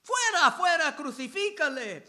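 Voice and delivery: loud, high repeated cry; preacher re-voicing the mob's furious yell; crowd shouting again. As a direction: hurl the fuera twice like a mob. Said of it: "Pero ellos gritaron".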